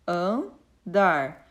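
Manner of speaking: "Andar" in two separate beats: an, dar; teacher dividing "Andar" into its two syllables; split into syllables. Shown as a demonstration. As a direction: say "Andar" with a gap after "An". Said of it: "Então, eu tenho duas sílabas".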